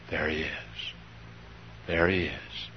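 near-whisper, low and soft; hushed, reverent recognition; hushed awe. Acted. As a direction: breathe it out softly, almost to yourself. Imagined awed sighting of Jesus.

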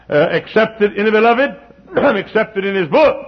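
high, loud preacher climb; preaching at full force. Real, not acted. Preacher lifting the congregation, rising and ringing. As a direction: build the sermon to a ringing peak.

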